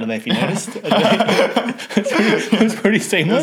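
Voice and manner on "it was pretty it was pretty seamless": high laughing voice, louder. Real, not acted. Teasing the co-host, laughing loudly. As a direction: laugh through the words. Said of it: "It was a bit off".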